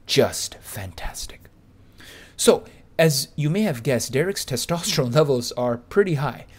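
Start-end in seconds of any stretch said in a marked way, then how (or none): 0.1-1.5 whispered. Real, not acted. Whispered admiration.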